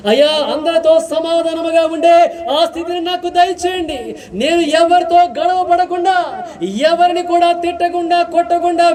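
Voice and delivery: high, ringing prayer voice; impassioned pulpit prayer; fervent prayer. Acted. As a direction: cry out to God for the congregation.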